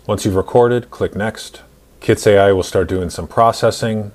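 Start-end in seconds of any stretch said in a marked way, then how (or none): none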